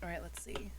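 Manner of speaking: whispered to herself; hushed whisper while waiting. Real, not acted.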